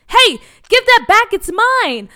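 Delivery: put-on boy yelling to bullies to give back his helmet, loud and high; angry shout. Boy to the bully holding his helmet, loud high protest. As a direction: shout it, grabbing for what's yours.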